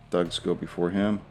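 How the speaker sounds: ordinary talk, nothing marked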